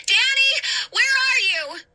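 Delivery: high loud call. Acted. Hannah searching for Danny in the fire, high loud call. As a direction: call out through the flames. Calling through burning house.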